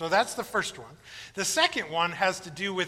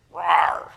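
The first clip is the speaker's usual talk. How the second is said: bear growl; 2 semitones lower, 6 dB louder than usual. Retold or imitated. Preacher imitates a grizzly bear.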